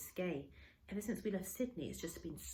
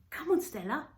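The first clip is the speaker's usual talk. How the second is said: coaxing call, much higher and louder; raised coaxing call to a dog; 9 semitones higher, 9 dB louder than usual. Retold or imitated. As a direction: call her in from the bow. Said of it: "I said".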